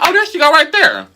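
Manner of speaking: mock discovery. Teller spotting the girl down the hallway, mock discovery. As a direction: call it out on a jump up in pitch.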